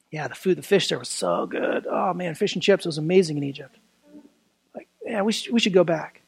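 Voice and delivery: low, soft, wistful mock moan; mock grumbling. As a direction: grumble under your breath like someone pining for old meals. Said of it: "They grumble".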